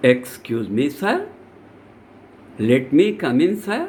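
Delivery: low, deferential request with rising ends; very polite. Shown as a demonstration. Student asking a busy official to enter, very polite. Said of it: "must be very polite".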